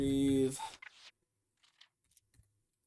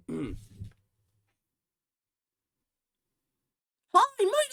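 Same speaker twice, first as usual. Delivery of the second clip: Naruto scratchy voice; 14 semitones higher, 7 dB louder than usual. Pretend. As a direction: squeal it like a hyper anime hero. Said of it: "the Naruto scratchy voice".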